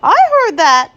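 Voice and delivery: loud, high mock indignation; mock stormy. A narrator's reading. Missus Rogers storming in at her joking husband. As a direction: burst in playfully miffed. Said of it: "Missus Rogers stormed in".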